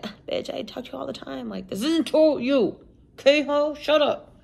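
high, playful mock-scolding at friends; sassy clapback. Vlogger sassing friends who thought the rant was about them, playfully. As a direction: snap back at your friends, teasing not angry. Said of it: "And I'm like".

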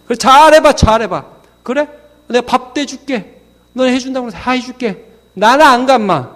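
high, loud huffy refusal; sulky dad. Acted. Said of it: "삐진 아빠. 딱 그 모습만". (삐진 아빠처럼 높고 크게 토라진 말투)

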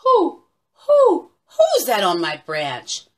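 loud hoots dropping into a scolding; loud, annoyed owl; cross owl. Acted. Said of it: "said Owl".

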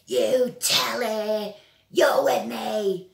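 loud, slow, drawn-out demand in a put-on voice; mock other woman. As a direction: mimic her bossy claim on him, loud and slow. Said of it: "she's like".

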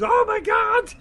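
high, loud shocked shout; shocked yell. Retold or imitated. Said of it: "that other guy goes".

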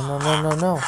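ordinary talk, nothing marked

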